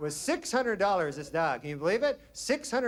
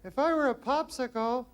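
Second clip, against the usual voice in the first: high put-on girlish voice, coy; mock girl's voice; 8 semitones higher than usual.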